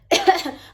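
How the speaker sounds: loud dry cough; coughing fit. Real, not acted. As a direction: cough hard, voice giving out. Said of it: "I'm losing my voice".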